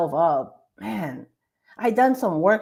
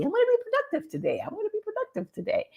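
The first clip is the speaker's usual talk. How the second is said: much higher, fast, squeaky puppy voice; mock doggy voice; 13 semitones higher, 6 dB quieter, much faster than usual. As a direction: yap it out like an excitable little dog. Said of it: "like this doggy".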